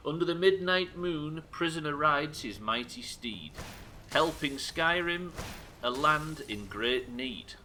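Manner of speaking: slow, grand recital of the verse; dramatic poem reading. Shown as a demonstration. Streamer reciting a chat poem, grand and slow. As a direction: declaim it like an epic trailer. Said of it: "reads viewer poem dramatically".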